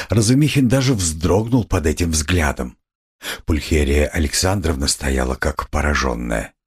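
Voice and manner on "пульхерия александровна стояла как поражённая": usual speaking voice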